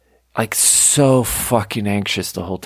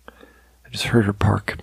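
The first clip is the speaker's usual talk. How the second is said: whisper; slower than usual. Real, not acted. Landlady returned, keeps voice down.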